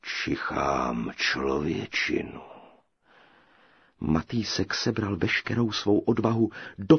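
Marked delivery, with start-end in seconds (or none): 0.0-2.4 low, slow giant voice; sniffing giant. A narrator's reading. Giant scents a hidden human, low slow voice. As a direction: draw it out low, scenting prey. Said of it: "a zavětřil".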